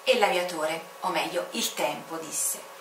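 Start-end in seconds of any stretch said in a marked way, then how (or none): none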